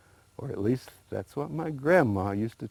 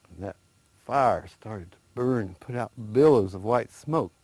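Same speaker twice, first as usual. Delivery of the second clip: ordinary delivery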